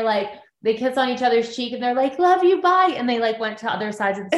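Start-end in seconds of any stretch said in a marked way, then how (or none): 2.2-2.9 put-on high, loud chirpy little-girl farewell; squeaky playful love you bye; cute quick goodbye. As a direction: toss it off sweetly and run. Girls kissing and running off.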